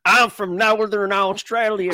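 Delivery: loud, high, drawn-out mock-Southern twang; mock Alabama accent. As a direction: tease the co-host's Australian accent as Alabaman. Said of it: "You sound like you're from Alabama".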